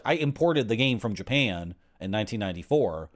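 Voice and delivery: usual speaking voice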